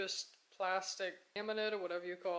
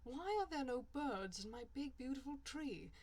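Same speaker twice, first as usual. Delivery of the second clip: a hammed-up English accent for a fake tree advert; posh English ad voice, played lightly; mock English accent; 3 semitones higher than usual. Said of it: "horrible English accent man talking about trees".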